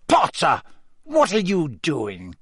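high, drawn-out, sharp demand; spitting Snape. A narrator's reading. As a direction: spit it out as a stern, irritated professor. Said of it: "spat Snape".

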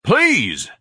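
a loud, high cry of protest; furious shout. A narrator's reading. Son cuts off his mother's story, furious shout. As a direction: cut her off, exasperated. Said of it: "Ignatius shouted furiously".

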